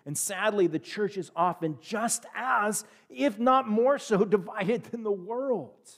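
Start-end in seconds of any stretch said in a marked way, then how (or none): none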